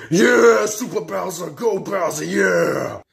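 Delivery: loud, high whooping cheer; root for your hero at full volume; wild cheering. Acted. Fan cheering Super Bowser on, wild shouting.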